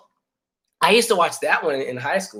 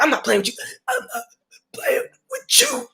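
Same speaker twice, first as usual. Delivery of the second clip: mock strained threat; 11 semitones higher than usual. Acted quote during choking fight.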